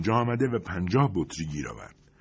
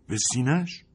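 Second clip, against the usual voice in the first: shocked question; 7 semitones higher than usual. Acted. Sergeant hearing where the shrapnel struck, shocked question.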